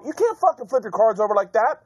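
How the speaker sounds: high-pitched indignant protest; retold anger. Pissed poker player scolding his opponent, indignant retold protest. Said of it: "And I got pissed".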